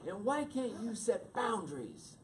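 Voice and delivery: hint of a hurt wife scolding; mock upset wife. Retelling wife's complaint about late call, mock scolding.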